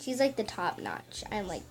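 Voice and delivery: ordinary talk, nothing marked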